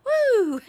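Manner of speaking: loud high woo at the heat; spicy hot whoop. Acted. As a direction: yelp at the spice. Tasting spicy chili dog.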